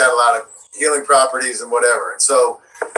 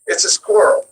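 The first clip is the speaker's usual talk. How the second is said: normal talking voice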